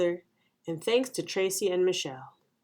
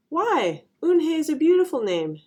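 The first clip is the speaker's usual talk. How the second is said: high, louder startled protest; surprised; 9 semitones higher, 6 dB louder than usual. A narrator's reading. Mother reacts to Eunhae's wish, surprised. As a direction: object in surprise, pitch jumping up. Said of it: "looked at her with surprise".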